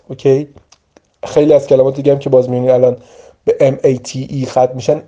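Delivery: ordinary talk, nothing marked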